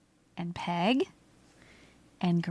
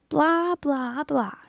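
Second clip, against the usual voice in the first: mocking blah; 5 semitones higher, slower than usual. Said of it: "they replied".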